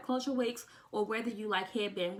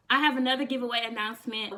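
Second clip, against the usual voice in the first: ordinary talk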